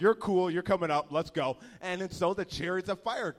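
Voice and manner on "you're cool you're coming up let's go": loud cheerful invite. Retold or imitated. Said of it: "God just is like".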